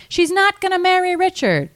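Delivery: high-pitched message passed along; relaying bystanders. Retold or imitated. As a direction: call the news down the line, high. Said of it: "the guys being like".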